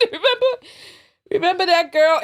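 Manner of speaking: laughing through the word. Real, not acted. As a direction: giggle it out. Laughing at a memory.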